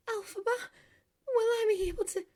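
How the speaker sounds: high, anxious, pleading question; hesitant. A narrator's reading. Girl doubts her wobbly legs, asking her sister, hesitant. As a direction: ask it high, suddenly unsure. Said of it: "she hesitated, glancing at her wobbly legs".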